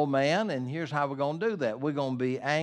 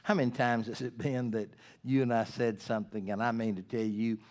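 ordinary delivery

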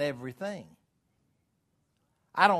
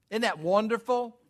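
usual speaking voice

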